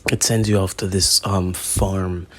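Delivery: normal talking voice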